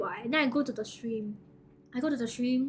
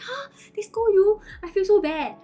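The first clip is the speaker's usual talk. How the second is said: higher and much louder, aghast; shocked dismay; 8 semitones higher, 8 dB louder than usual. Retold or imitated. Streamer learning her viewer got scolded, loud high dismay. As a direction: blurt it out, horrified for your viewer.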